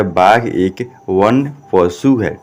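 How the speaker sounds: slow, separated Hindi words; model reading. Shown as a demonstration. Teacher reading out the Hindi translation for students, slow word-by-word. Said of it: "क्या पढ़िएगा".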